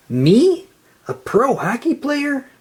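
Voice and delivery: high, breathless wonder; can't believe his luck; excited. A narrator's reading. SpongeBob stunned by the hockey offer, excited.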